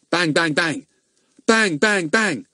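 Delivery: high, sharp bang bang bang. A narrator's reading. Narrator imitating banging on a door. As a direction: call out the bangs like knocks.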